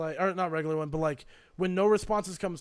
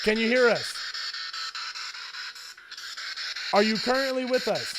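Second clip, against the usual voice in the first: calling out to spirits; 3 semitones higher than usual. Acted. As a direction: call out into an empty room. Asking the spirit box questions.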